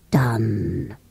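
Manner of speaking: very low, drawn-out groan of a word; collapsed creature. Acted. Creature collapsing after granting a wish, low and spent. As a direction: deflate on the word, deep and spent. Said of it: "collapsed, and said".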